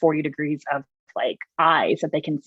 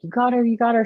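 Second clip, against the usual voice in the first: excited cheer; 7 semitones higher, faster than usual. Retold or imitated. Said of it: "My hunting partner was like".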